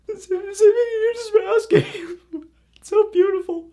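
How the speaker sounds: very high, squeaky mock crying; hammed-up weepy falsetto, overcome by beauty; mock tearful. Vlogger overwhelmed by a beautiful name, mock tearful.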